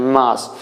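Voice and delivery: "मास" as "maas", with a long open aa; low "maas" for "मास", the popular way; common mispronunciation. Shown as a demonstration. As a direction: say "मास" with the vowel of "father". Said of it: "normally लोग इसको मास कहते हैं".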